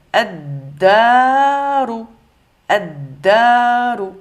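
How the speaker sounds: slow "Ad-daru" with a doubled D and long loud A; shadda sur dal. Shown as a demonstration. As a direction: lean on the D, stretch "Ad-daru". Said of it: "shadda sur le dal". (« Ad-daru », D doublé, A allongé)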